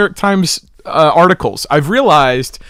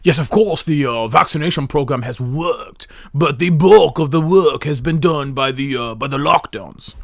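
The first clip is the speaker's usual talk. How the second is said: mock British accent, posh parody. Host parroting the British speaker's clip, in a hammy British accent. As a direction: put on an exaggerated British accent. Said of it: "My British accent is pristine".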